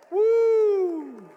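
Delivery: a very high, loud whoop of joy; exultant woo. Real, not acted. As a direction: let out a celebratory holler. Preacher's exultant cry after verse.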